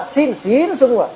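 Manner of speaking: every letter said as sin, slow; mocking the careless reader; mock all-sin lisp. Shown as a demonstration. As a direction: slur every letter into one sin sound. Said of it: "Ngucapin huruf aja sama semua".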